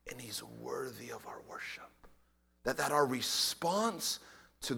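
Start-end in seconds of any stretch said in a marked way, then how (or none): 0.1-1.9 soft whisper of awe. Acted. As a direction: breathe it reverently. Preacher whispers point.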